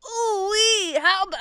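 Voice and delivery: very high, squeezed straining cartoon voice. Shown as a demonstration. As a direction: squeeze it out with real effort. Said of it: "need to go to the bathroom".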